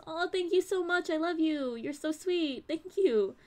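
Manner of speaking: high-pitched, touched gushing; gushing thanks. Real, not acted. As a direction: gush sweetly at a kind fan. Gushing thanks to a congratulating viewer.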